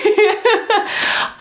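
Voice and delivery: high-pitched real giggle. Real, not acted. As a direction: burst into a squeaky laugh. Laugh after blubbering mess.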